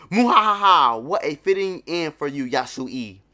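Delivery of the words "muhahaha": loud, high villain cackle; evil laugh. Acted. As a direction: cackle like a tyrant. Voicing villain Orochi's laugh.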